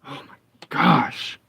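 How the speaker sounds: slow, breathless whisper; whispered oh my gosh. Real, not acted. Gamer whispering in fear at a noise in the game. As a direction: hush it out in alarm. Scared gamer hears something.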